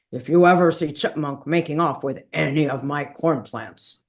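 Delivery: gruff warning. A narrator's reading. Gila Monster warns Messenger Fly about his corn, gruffly. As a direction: order it gruffly. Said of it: "he said gruffly".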